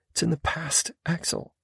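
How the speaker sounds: lightly voiced woman, letting go. A narrator's reading. Female character.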